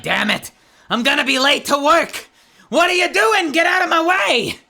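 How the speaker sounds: loud, high furious clown yell; road rage as Krusty. Acted. Yelling at other drivers in Krusty's voice. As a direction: scream at the traffic like an angry clown. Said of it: "The Krusty is you with road rage".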